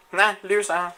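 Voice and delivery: high, loud gloating taunt. Real, not acted. Gamer mocking a player he just beat, loud gloating taunt. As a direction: rub the loss in his face.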